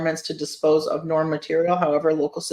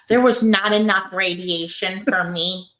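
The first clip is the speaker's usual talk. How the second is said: nerdy voice. Pretend. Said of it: "read it in, like, a nerdy voice".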